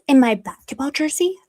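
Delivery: usual speaking voice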